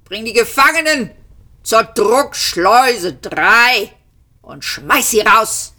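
high, imperious Vogon order; furious command. A narrator's reading. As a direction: call the guard sharply, then draw out the order. Commander orders guard to eject prisoners.

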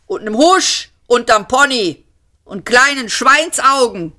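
high, condescending loudhailer call; megaphone voice. A narrator's reading. Police mock the fugitive through a megaphone, condescending. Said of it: "von neuem das Megafon".

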